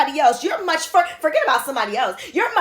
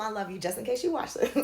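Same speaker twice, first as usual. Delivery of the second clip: usual speaking voice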